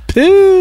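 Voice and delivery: very high squeaky "Püh"; high spit-out puff. Acted. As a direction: blurt "Püh" out as a shrill puff. Dismissive burst. (yüksek perdeden "Püh" sesi)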